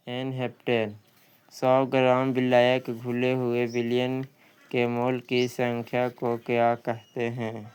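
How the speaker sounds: usual speaking voice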